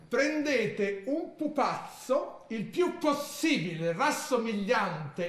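slow, grave ritual reading; slow, solemn reading of a spell manual; solemn instruction reading. Acted. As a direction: read each step like a sacred rite. Reads a ritual manual aloud.

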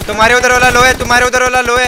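loud, insistent warning, repeated; urgent shout. Real, not acted. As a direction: call it out loud twice. Warning teammate about enemy.

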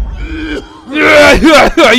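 shouted laughing outburst; loud burst of laughter. Real, not acted. Player bursting into loud laughter at the game.

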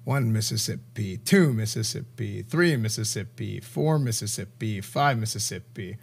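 low, quiet, empty-headed counting; slow blank counting. Retold or imitated. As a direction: count like nothing's upstairs. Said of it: "what's going through Ginny's head".

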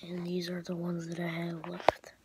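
soft breathy whisper, voice off. Real, not acted. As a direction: keep it hushed while parents sleep. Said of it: "I'm whispering".

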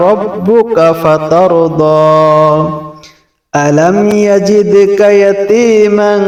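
high, melodic chanted recitation of the surah; Quran recitation. Acted.

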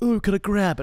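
eager clubgoer. Retold or imitated. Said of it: "looking around like".

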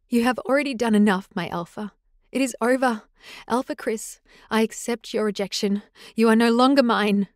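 hurt but firm, rising; defiant acceptance. Acted. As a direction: end it with dignity. Rosalie accepts rejection defiantly.

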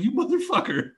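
high, laughing curse through giggles; cursing while cracking up; a laughing mock curse; laughing insult. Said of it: "And he's like".

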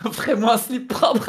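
slow, soft words through laughter; laughing. Real, not acted. Co-host joking about the scare, laughing through it. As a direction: laugh it out as a joke. Joking after near miss.

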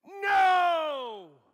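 huge high scream of defeat; Satan's cry. Acted. Satan realizing he has lost, screaming high and loud. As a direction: howl as the head is crushed. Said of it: "And Satan goes".

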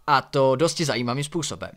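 ordinary delivery, nothing marked

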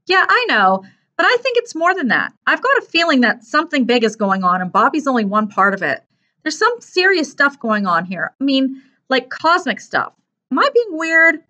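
put-on high, excitable young character; earnest boy character, uneasy. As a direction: voice an earnest boy sharing a big hunch. Narrator voices Mark.

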